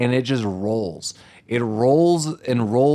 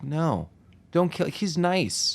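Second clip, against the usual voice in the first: soft, deadpan, a little higher; offhand plea with no urgency; matter of fact; 3 semitones higher, 6 dB quieter, slower than usual. Retold or imitated. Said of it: "not pleading. It's just, like, matter of fact".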